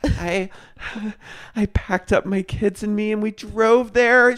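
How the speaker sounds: high, halting mock-tearful voice; imitated tearful joy. Imitating a mother crying with relief upon arriving, high mock-tearful voice. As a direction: act overcome, pitched high and haltingly. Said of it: "the way that she cried".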